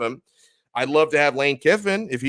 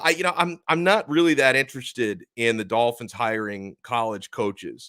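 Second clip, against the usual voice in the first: normal talking voice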